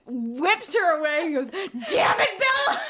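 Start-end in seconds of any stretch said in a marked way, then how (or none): 1.5-2.8 higher-pitched mock fury breaking into laughter; hammed-up exasperated yell; mock angry outburst. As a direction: act out an angry curse, cracking up. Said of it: "he's super angry".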